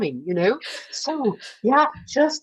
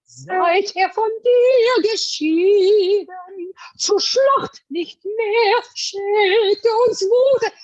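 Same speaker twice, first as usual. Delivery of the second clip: drawn out very slowly, much higher; slow German demo line; 11 semitones higher, 3 dB louder, much slower than usual. Shown as a demonstration. A vocal coach demonstrating the line slowly and high to her student. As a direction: lay out each word slowly and high. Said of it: "This is now only telling".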